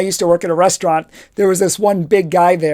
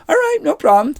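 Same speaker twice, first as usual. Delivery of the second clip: higher, light carefree "all right"; breezy backing off; 9 semitones higher than usual. Retold or imitated. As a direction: shrug it off cheerfully. Backs off from threat.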